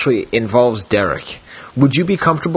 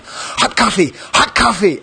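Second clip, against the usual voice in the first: higher, mock panicked cry; 6 semitones higher than usual. Friend retells Derek's panic, mock panicked. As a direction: imitate Derek running around in a panic. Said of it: "run around in a panic going".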